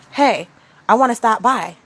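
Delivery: a lightly voiced commander announcing a visit; commanding voice. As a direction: announce it as if giving orders. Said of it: "in a commanding voice, like a commander".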